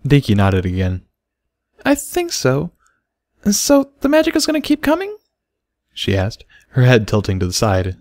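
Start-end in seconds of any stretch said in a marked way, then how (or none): 1.8-5.2 put-on much higher small child voice; a shy little filly confessing; little foal voice. A narrator's reading.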